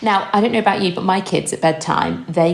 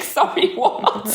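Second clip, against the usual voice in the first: mock disbelief; 8 semitones higher, much slower than usual. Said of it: "I was like".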